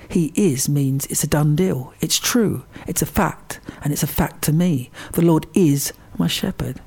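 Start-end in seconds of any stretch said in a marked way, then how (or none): 5.1-6.8 "is" leaned on and held; stress on is. Shown as a demonstration. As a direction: state it with firm weight on "is". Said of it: "is emphasised".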